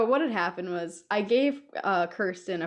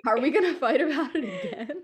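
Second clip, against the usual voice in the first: higher, amused question through a laugh; 6 semitones higher, 3 dB louder than usual. Real, not acted. Co-host teasing friend about fighting again, laughing. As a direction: tease with a laugh breaking in.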